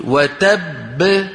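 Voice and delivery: very slow "وتب" pressing the doubled ب at the stop; slow tajweed demo; stressed stop with qalqala. Shown as a demonstration. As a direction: hold and bounce the ب of "وتب". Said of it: "ولما بتوقف بتقول".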